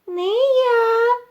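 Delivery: very high, squeaky refusal; mouse calling out refusal. A narrator's reading. Mouse Krut refuses to carry grain, high call. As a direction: sing out a tiny squeak. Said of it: "гукає круть".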